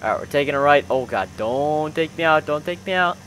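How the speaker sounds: ordinary talk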